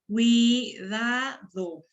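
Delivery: cuidado very slowly as cui, da, do; syllable by syllable. Shown as a demonstration. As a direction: pull cuidado apart into three long, separate syllables. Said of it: "Esta palabra tiene puras sílabas abiertas".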